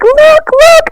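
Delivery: very high squeal of delight; thrilled, excited. Retold or imitated. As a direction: squeal it like you can't contain yourself. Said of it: "so thrilled and excited".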